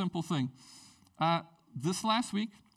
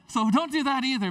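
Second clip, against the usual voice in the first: louder, higher mock-stern caution; playful warning; 7 semitones higher, 7 dB louder than usual. Real, not acted. Pastor joking with congregation, playful warning. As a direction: wag a finger with a grin.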